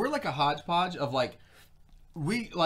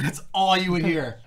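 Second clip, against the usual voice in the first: usual speaking voice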